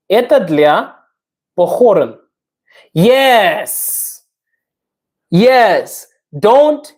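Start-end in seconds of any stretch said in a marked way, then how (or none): none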